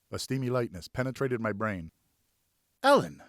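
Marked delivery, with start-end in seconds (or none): 2.8-3.2 surprised. Acted. Surprised at Ellen's name.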